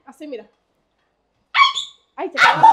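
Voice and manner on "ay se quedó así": ay, mucho más agudo y fuerte; sorpresa aguda. Real, not acted. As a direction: suelta un ay de susto. El aceite salta. (sorpresa aguda y fuerte)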